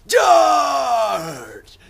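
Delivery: high, drawn-out hammed-up war shout; battle cry. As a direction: bellow a mock cavalry charge. Mock battle cry of Jack.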